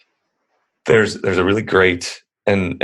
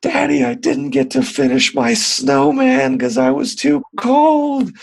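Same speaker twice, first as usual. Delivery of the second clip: high, slow, whiny child voice mimicking a tearful daughter; crying little girl; slower than usual. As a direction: play the upset little girl, much higher and drawn out. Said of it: "crying like".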